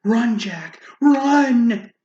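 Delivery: rising loud run; urgent cry. Acted. Giantess urging Jack to run, rising loud. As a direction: urge him to flee.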